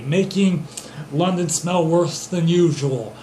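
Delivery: ordinary talk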